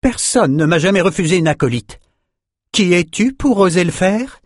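voix plus aiguë, colère contenue; ton qui monte. Acted. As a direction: hausser le ton avec hauteur. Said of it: "La mémoriste haussa le ton". (Victoria qui s'emporte contre Nietzsche)

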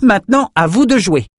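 high, excited handover; mischievous high-pitched cry; playful call. A narrator's reading. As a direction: toss the challenge to allies. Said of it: "son espièglerie coutumière".

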